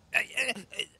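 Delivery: high yelps of pain. Acted. Bitten by the feverish friend, high yelps. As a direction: yelp as he is bitten.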